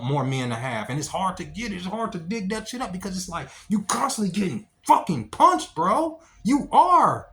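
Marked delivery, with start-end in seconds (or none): 3.7-7.3 emphatic insistence. Real, not acted. Insisting life keeps punching you.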